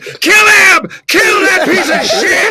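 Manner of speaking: high, loud drawn-out shout; imitated fever-pitch mob. Crowd turning on the comedian, high frenzied mock shouting. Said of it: "it got to a fever pitch".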